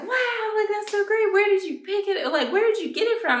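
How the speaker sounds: high, gushing mock shopper voice; admiring shopper. Mother and daughter admiring the church, in a high gushing voice. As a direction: coo over it like a thrilled stranger. Shoppers ask where found.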